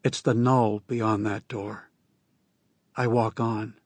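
plain reading voice

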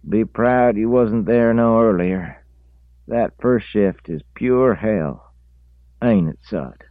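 high, slow drawn-out country talk; rural drawl. A narrator's reading. Reese voiced.

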